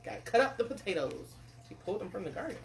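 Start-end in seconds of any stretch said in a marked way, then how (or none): none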